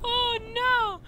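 very high squealed lament; high-pitched dismay. Real, not acted. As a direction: squeal it through giggly dismay. Squeals at the crash.

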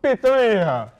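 loud, high Italian shout, falling away; yelled mock Italian. Shown as a demonstration. Guest showing the host how, yelled mock Italian. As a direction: belt it out like an Italian caricature. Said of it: "you just have to yell words".

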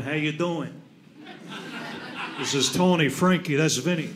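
lightly voiced low Italian tough guy; a hint of low-pitched wiseguy. One of three Italian guys introducing the others, lightly acted. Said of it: "you know someone's Italian".

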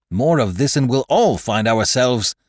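urgent warning. A narrator's reading. Mate parrying a knife stroke, urgently calling a halt. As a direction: call out a warning to stop.

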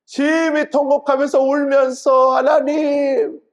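high, loud, slow wailing plea; wailing cry. Retold or imitated. Preacher voicing Hezekiah weeping bitterly, loud wailing cry. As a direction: stretch it out like sobbing to God. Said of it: "심히 통곡했어요".

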